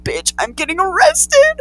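high, slow, drawn-out shock; retold disbelief. Kid told to come with police, retold disbelief. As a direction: climb into outraged mock disbelief.

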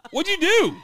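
high, loud alarmed question; shocked question. Retold or imitated. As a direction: blurt it out in alarm. Dad asks daughter in shock.

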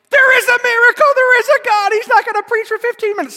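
very high, loud hallelujah cry; mock jubilation. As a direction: shriek it like an overjoyed churchgoer. Imagined churchgoers rejoice at no homily.

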